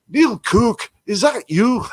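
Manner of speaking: high, loud mock Scottish call; imitated Scottish voice. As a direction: call out in a put-on Scottish accent. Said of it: "in this other Scottish voice".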